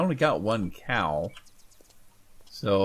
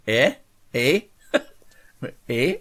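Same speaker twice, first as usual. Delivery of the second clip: playful Canadian "eh?" with a laugh; mock-Canadian tag question, rising and amused; joking Canadian "eh"; 3 semitones higher, 3 dB louder, slower than usual. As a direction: toss out teasing, questioning Canadian ehs. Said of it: "a Canadian accent".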